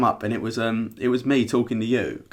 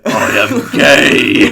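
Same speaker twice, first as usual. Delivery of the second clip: loud, very drawn-out Groot impression; 2 semitones lower, 9 dB louder, much slower than usual. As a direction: bellow it slowly like Groot. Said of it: "in, like, the Groot voice".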